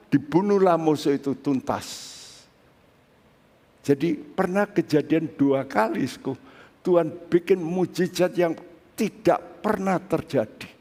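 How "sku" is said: ordinary delivery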